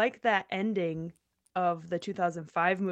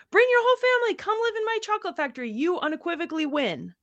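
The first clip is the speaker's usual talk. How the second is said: excited Wonka voice; 10 semitones higher, 4 dB louder, faster than usual. Retold or imitated. Wonka's exuberant final offer.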